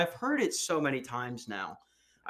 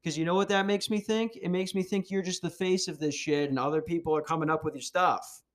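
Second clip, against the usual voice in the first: ordinary delivery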